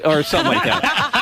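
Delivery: ordinary talk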